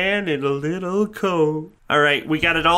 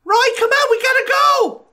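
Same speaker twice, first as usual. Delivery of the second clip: high frantic call, much higher; scared child shout; 3 dB louder than usual. Acted. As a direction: yell at Roy to run. Urging friend to flee.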